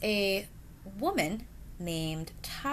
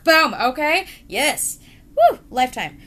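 much louder, higher hype; excited cheer; 7 semitones higher, 12 dB louder than usual. Real, not acted. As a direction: whoop it up like a TV promo. Hyping the romance and murder.

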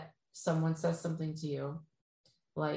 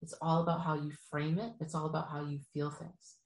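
usual speaking voice

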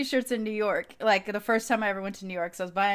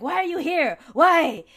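mock scolding, high and loud; mad grandma; 5 semitones higher, 7 dB louder than usual. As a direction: imitate an outraged grandmother demanding an answer. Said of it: "she gets really mad".

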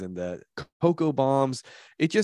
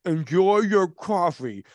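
slow, higher, talking through a mouthful; mouth full; 5 semitones higher, 4 dB louder, slower than usual. Acted. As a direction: say it with cheeks stuffed. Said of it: "said with my mouth full".

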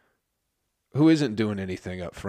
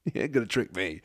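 normal talking voice

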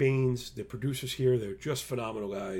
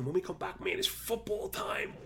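whispering; 7 semitones higher, 4 dB quieter than usual. Real, not acted. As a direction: whisper it like a secret treat. Hushed tease before football.

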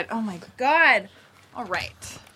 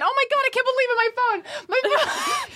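high, laughing mock panic; hammed-up flustered squeal; mock flustered guest. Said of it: "You're like".

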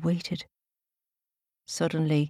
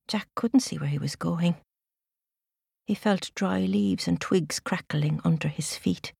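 usual speaking voice

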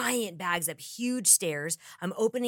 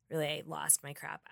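softer, lower, embarrassed admission; sheepish; 5 semitones lower, 9 dB quieter than usual. Retold or imitated. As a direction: own up to it quietly, a bit ashamed. Embarrassed recap of her outburst.

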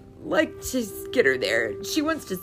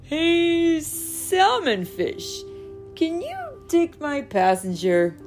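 higher, louder call across the water; 8 semitones higher, 7 dB louder, slower than usual. Acted. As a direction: hail the salmon from afar, slow. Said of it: "called Silverback".

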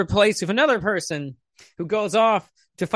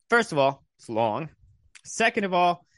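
usual speaking voice

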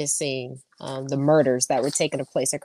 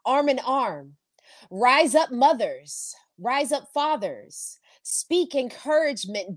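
rallying cry, rising pitch; fiery revolutionary speech; 5 semitones higher, slower than usual. Acted. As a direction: call the people to rise up. Poem builds to a rallying call.

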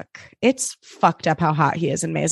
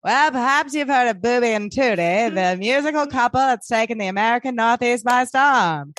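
put-on old-time radio announcer, slightly raised pitch; sixties radio announcer; 3 semitones higher than usual. Host introducing a musical couple on air, radio announcer voice. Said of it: "this, like, radio announcer".

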